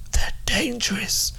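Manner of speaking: whispered warning. Acted.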